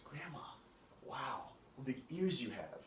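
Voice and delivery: soft Grandma, then a drawn-out marvelling wow; childlike amazement. Acted. Girl marvels at disguised wolf.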